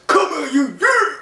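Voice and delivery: high, loud mock wrestling yell; imitating wrestler's attack. Big Show grabbing Alberto by the hair, aggressive mock yell. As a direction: roar it like a wrestler grabbing his man. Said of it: "he's like this".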